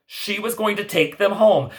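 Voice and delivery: ordinary delivery, nothing marked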